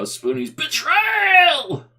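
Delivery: high, slow dramatic yell of betrayal; dramatic shout. Pretend. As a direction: howl it like a wounded soap villain. Meme quote shouted mid story.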